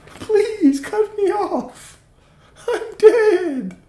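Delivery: very high, slow, squeaky plea; pleading toenail voice. Pretend. Dying toenail begging to be cut off, squeaky and laughing.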